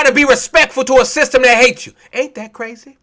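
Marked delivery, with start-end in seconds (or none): none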